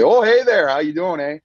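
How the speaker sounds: high, loud Canadian "oh hey there, how you doing, eh"; hammed-up Canadian impression; Canadian accent. Player retells the podcast bit in a loud Canadian accent. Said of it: "they talk in those Canadian accents".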